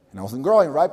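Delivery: ordinary talk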